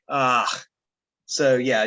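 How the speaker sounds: ordinary delivery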